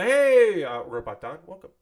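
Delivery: delighted greeting. Real, not acted. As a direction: hail a newcomer with a big long hey. Greeting someone arriving in chat.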